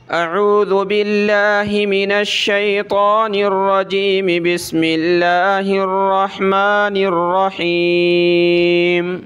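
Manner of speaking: very slow, drawn-out chanted recitation; Quranic recitation. Acted. Teacher starting the lesson's reading, slow melodic recitation. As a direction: stretch every syllable in a melodic intonation.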